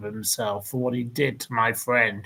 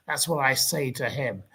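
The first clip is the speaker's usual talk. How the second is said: usual speaking voice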